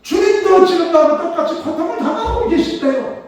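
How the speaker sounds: loud, high, impassioned sermon climax; full-force preaching. Acted. Preacher proclaiming God shares his suffering: loud impassioned preaching. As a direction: preach it out with fire.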